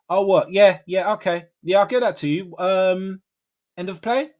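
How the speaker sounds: high, obliging office buzzword voice; work call voice. Shown as a demonstration. Worker promising a caller delivery, in work voice. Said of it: "with the buzzwords in there".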